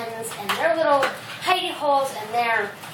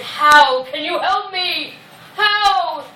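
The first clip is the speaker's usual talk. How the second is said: demanding lion; 3 semitones higher, 6 dB louder, much slower than usual. Acted. Lion character questions the mouse.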